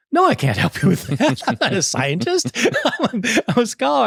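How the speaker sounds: high, laughing mock protest; amused, high-pitched retold refusal.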